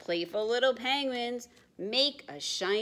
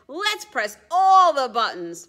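much louder gleeful shout; excited baby penguin; 6 semitones higher, 14 dB louder than usual. Acted. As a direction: shout it with mischievous glee. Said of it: "A baby penguin".

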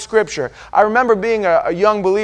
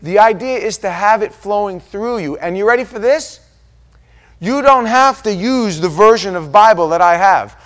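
ordinary talk, nothing marked

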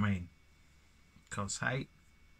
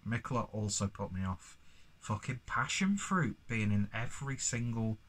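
usual speaking voice

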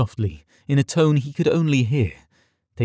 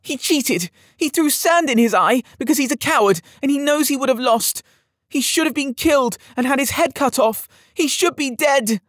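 put-on high child's voice, quivering with rage; a spoiled young lord near tears; tearful angry boy; 14 semitones higher than usual. A narrator's reading. Boy lord accusing the rhino of cheating, high and tearful. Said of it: "quivering at the lips and tearful".

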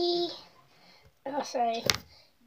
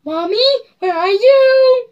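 scared crying out; 6 semitones higher, 8 dB louder, slower than usual. Retold or imitated. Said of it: "and I was like".

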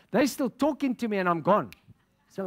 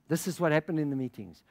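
normal talking voice

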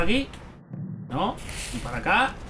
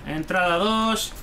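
normal talking voice

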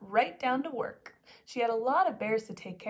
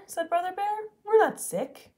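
higher, louder protesting cub; indignant cub; 8 semitones higher, 8 dB louder than usual. Acted. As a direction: protest like an upset kid.

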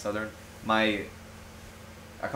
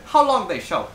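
put-on a sudden slip into a Southern accent, higher, laughing; slipping into a Southern accent, laughing; 7 semitones higher, 6 dB louder than usual. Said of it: "I said / my Southern".

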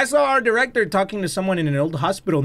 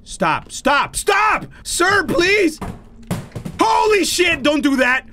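panicked yelling; 10 semitones higher, slower than usual. Real, not acted. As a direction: yell at the game to stop. Door bust open in horror game.

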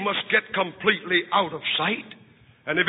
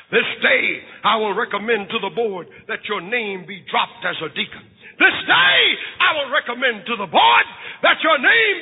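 loud, high, rising proclamation; thundering judgment; 4 semitones higher, 4 dB louder than usual. Acted. Priest condemns John before the church, loud and rising. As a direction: build each "This day" higher.

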